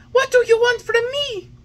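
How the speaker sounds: put-on high, loud startled child voice; frightened puppet. A narrator's reading. Puppet crying out at the coffin-bearing rabbits. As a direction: cry out at the rabbits.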